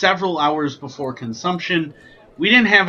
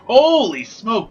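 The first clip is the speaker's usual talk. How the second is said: high, drawn-out amazed exclamation; astonished; 8 semitones higher, 4 dB louder, slower than usual. Real, not acted. Taster reacting to first sip of smoky whiskey, astonished. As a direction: stretch it out in real surprise.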